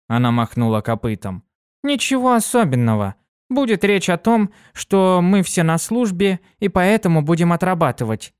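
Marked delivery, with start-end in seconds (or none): 1.8-8.3 высокий бойкий девичий голос; звонко и живо, как юная пегаска; бойкая пегаска. Acted. Дитси объясняет. (Дитси рассказывает другу, высоко и бойко)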